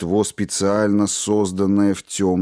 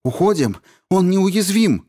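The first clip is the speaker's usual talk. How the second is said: high loud scared yell; frightened shout; 11 semitones higher, 4 dB louder than usual. Acted. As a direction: cry out in real fear. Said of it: "В голосе кричавшей слышался неподдельный страх".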